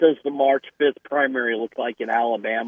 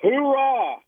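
lively hoorah; 11 semitones higher than usual. Real, not acted. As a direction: shout it like a marine. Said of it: "lively hoorah [name]".

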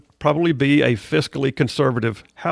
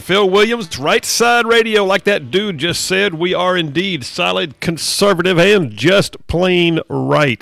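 loud, high-energy announcer read; big radio host intro; radio show opener; 5 semitones higher, 5 dB louder, slower than usual. Acted. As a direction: punch out the station ID, pitched up.

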